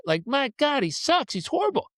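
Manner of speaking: high-pitched whining complaint; hammed-up teammate griping; mock exasperation. Teammates despairing at math kid.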